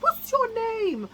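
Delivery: slow high sing-song question; high woman voice. Retold or imitated. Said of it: "she said".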